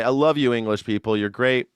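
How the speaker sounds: ordinary delivery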